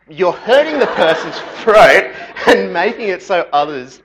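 loud British scolding, laughing through it; British accent. Pretend. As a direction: keep the British rebuke going, breaking into a laugh. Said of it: "I don't know why I'm British".

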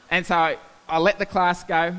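normal talking voice

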